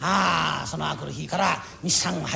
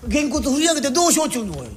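yelling at his own fist as it lifts; alarmed shout; 7 semitones higher than usual. Acted. Man shouting at his own raised fist in alarm.

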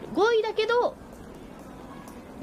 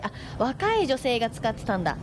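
usual speaking voice

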